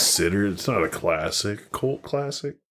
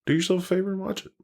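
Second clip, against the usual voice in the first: fast, high sales pitch; excited plug; 11 semitones higher, much faster than usual. Real, not acted. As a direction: rattle it off like an ad. Pitching the movie to listeners.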